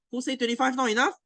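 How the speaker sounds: high, fast taunt; mocking challenge. Teacher mocking students who want sixteen, high and fast. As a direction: challenge them, pitch up high and quick. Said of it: "repeated rhetorical jab".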